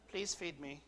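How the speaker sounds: lightly put-on Cockney, softly spoken; mock Cockney accent. Preacher imagines baby Jesus asking mother for food, mock Cockney accent. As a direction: make it a polite little mock-Cockney request. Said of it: "in his best Cockney accent".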